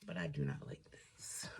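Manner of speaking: breathy whisper, quietly annoyed; whispered aside. Real, not acted. Whispering to viewers about a tool she dislikes. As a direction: mutter it under your breath in frustration.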